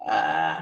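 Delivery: low creaky vocal fry "uh". Shown as a demonstration. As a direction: let out a low fry croak. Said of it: "this sound is what we call the vocal fry".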